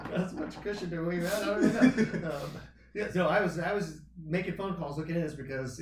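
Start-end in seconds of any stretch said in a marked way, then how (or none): 0.0-2.3 high giggling through words; laughing. Real, not acted. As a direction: laugh while you talk. Laughing at fart remark.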